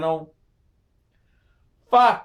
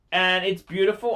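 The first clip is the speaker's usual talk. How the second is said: ordinary talk, nothing marked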